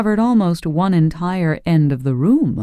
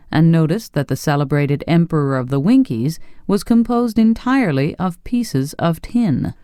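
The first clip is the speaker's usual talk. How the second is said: ordinary delivery, nothing marked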